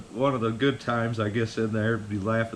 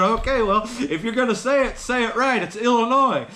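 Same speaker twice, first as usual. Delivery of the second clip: mock correcting; 13 semitones higher, 7 dB louder than usual. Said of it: "And I said".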